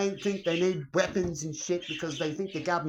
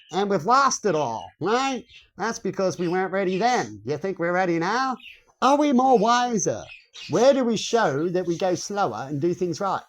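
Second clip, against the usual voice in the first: usual speaking voice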